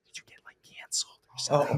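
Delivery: hushed, unvoiced mock whisper; imitated discreet whisper. Girl delicately probing the bar host's downfall, mock whisper. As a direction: whisper it as if it's scandalous.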